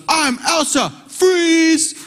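put-on high, commanding child voice; little girl voice. Father imitating his daughter as Elsa, high little-girl command. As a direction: cast a spell like a little girl. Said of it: "she goes".

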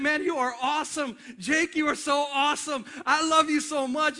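high, loud gushing encouragement; loud, excited praise. Acted. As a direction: get carried away cheering someone on. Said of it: "he's not talking this loud".

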